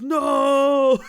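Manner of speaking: long, high, loud mock-dejected no; sad wail. Weirdo told the party is over, loud mock-sad wail. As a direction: wail it like a crushed party guest. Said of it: "He got all sad".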